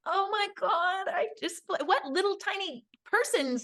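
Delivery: high, slowed gasp of wonder; amazed. Retold or imitated. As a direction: breathe it out in awe. Mom amazed at toddler question.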